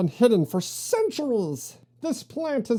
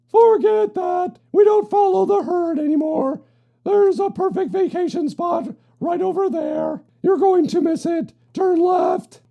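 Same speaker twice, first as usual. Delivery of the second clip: shouting; 7 semitones higher, 7 dB louder than usual. Acted. Throng ships arguing over direction.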